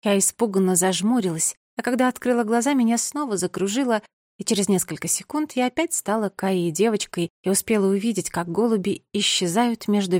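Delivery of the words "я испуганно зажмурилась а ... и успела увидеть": ordinary delivery, nothing marked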